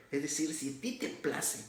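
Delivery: ordinary delivery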